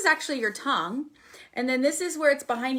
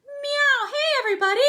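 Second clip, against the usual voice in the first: high, open, full cartoon mouse voice; loud, very high squeaky-hollow character voice; Mickey Mouse; 6 dB louder than usual. Shown as a demonstration. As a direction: lift it into a hooty head voice. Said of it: "it's that Mickey Mouse".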